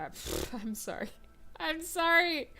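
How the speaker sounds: ordinary talk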